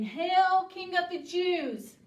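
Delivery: high, loud mock salute; mocking hail. Soldiers bow to make fun of Jesus, mocking. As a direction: proclaim it in mock homage. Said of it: "doing this to make fun".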